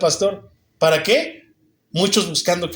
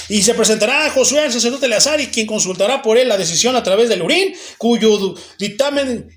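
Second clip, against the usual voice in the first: mock heedless reading, pitched up; careless Bible reading; 5 semitones higher than usual. Preacher imitating people who skim the Bible, mock careless reading. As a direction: rattle off the verse without stopping to think. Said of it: "Leamos la Biblia detenidamente, no la leamos y".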